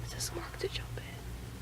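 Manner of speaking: slow whisper. Real, not acted. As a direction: whisper it slowly. Quiet aside after van dies.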